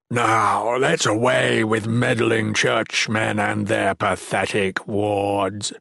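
slow, drawn-out villain voice; contemptuous monster. Acted. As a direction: sneer it slowly, stretching every word. Mickik, monstrous villain speaks.

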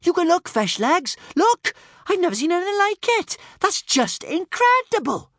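very high, loud excited squeal; shrieking with astonished delight; amazed gasping. Acted. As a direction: cry out in high-pitched amazement. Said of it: "gasped Kamikaze".

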